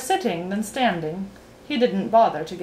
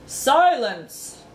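king's command; 5 semitones higher, 4 dB louder than usual. A narrator's reading. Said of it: "suggested the King".

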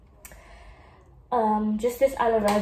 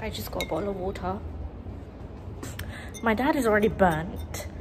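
ordinary talk

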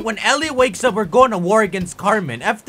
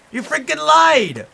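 loud, higher, furious outburst at being lied to; real angry shout; 8 semitones higher, 6 dB louder than usual. Real, not acted. Player to the lying villager, a real furious shout. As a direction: let it explode, betrayed and yelling. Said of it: "I'm so mad".

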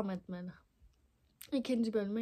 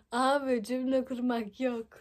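ordinary talk, nothing marked

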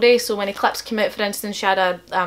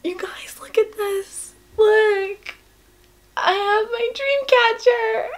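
very high, tearful squeak; real overwhelmed high voice; emotional and happy; 12 semitones higher, slower than usual. Real, not acted. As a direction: squeak it out, overcome with joy. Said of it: "this emotional and this happy".

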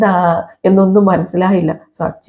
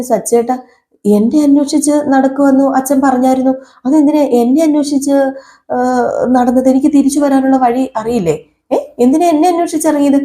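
higher, innocent wife's voice; young wife's voice; 6 semitones higher than usual. Retold or imitated. Wife asks husband why he searched, in a higher voice. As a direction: lift the voice into the wife's puzzled tone. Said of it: "Revathi പറഞ്ഞു".

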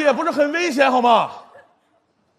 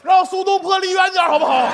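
high-pitched comic complaint; high, fed-up protest; exasperated plea; 7 semitones higher than usual. Acted. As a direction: plead in exasperation, voice held high. Complains about the recurring gag.

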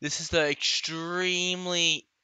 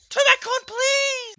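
very high, whiny begging shout; high wailing please; desperate plea. Real, not acted. Begging the fan to restart.

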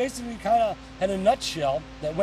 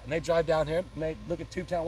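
ordinary talk, nothing marked

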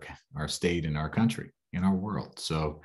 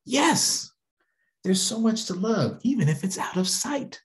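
beam with relief and joy; delighted child. Acted. Little girl no longer afraid answers, high and happy.

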